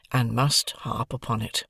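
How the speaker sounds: plain reading voice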